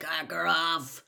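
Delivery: growled, drawn-out threat. A narrator's reading. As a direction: growl it slowly. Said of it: "growled a voice from near the door".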